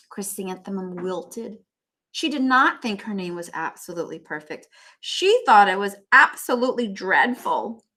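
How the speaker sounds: read-aloud storybook voice; story narration. A narrator's reading. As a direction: keep reading the teasing scene to the class. Reading classmates' teasing.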